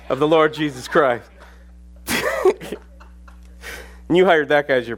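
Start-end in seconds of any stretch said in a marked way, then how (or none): 2.1-2.7 high laugh. Real, not acted. Laughs at own story.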